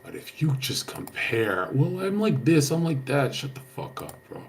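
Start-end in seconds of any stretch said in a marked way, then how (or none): 1.7-3.4 mocking comparer.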